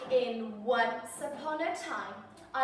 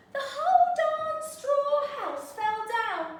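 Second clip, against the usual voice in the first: high, louder, almost sung; gleeful sing-song; 11 semitones higher, 6 dB louder than usual. Acted. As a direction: announce it with glee. Excited climax.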